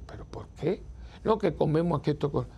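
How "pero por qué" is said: unvoiced whispered "pero ¿por qué?"; hushed puzzled whisper; whispered question.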